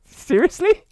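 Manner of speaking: loud, high disbelieving laugh; shocked and amused, loud; high loud mock outrage; surprised laughing outburst. Real, not acted. Reacts to viewer unfollowing.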